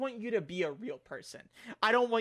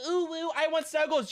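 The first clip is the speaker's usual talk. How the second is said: put-on higher, babyish cooing; mocking cutesy voice; 8 semitones higher than usual. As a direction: coo it like a clingy fan. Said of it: "where it's like the".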